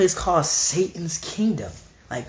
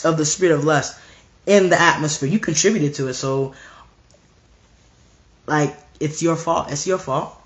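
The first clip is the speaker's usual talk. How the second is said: ordinary talk, nothing marked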